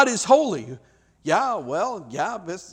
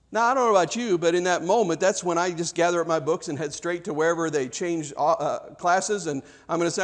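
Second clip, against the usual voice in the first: usual speaking voice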